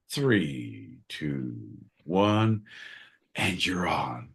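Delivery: low, hushed studio countdown; director's countdown. Pretend. Host cueing John to start his read, hushed countdown. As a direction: count them in softly and low.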